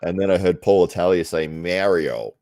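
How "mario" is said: "Mario" said the American way; "Mario" in imitated American pronunciation. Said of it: "they say it like that".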